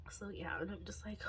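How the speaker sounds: soft breathy near-tears whisper; teary whisper. Real, not acted. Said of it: "Let me stop the waterworks".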